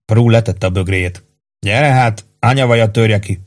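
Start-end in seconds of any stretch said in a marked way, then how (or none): none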